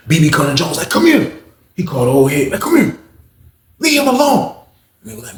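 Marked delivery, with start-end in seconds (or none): 0.9-1.3 high-pitched summons; calling over. Retold or imitated. Acts calling the old head over. 2.5-4.6 high, insistent summons; calling someone off. Retold or imitated. Acts calling the old head off.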